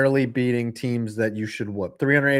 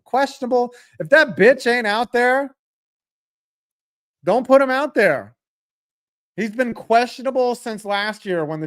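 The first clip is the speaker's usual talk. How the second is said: much higher, louder indignant yell; outraged outburst; 10 semitones higher, 5 dB louder than usual. Real, not acted. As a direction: blow up at the injury report. Outraged at kicker news.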